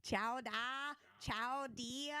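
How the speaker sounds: loud mock Boston politician; Mayor Quimby. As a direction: draw out a cartoon Kennedy-style Boston accent. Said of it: "It was Mayor Quimby".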